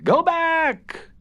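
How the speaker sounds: very high, loud, eerie little voices; shrill urgent warning from tiny figures; crying out. A narrator's reading. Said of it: "they cried".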